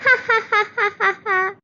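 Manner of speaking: high, mocking group laugh; jealous friends laughing at Malti. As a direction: cackle at her poverty. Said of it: "सभी सहेलियां उसके ऊपर हंसने लगती है".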